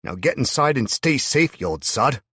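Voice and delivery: called out loud and high over the shoulder; calling back. A narrator's reading. Hero calls back to Marcus while riding off, loud. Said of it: "calling back to him as I went".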